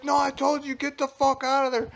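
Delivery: very high mock shout, insistent; retold angry yell. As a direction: act out the pissed-off guy insisting. Retold pissed man repeats his order.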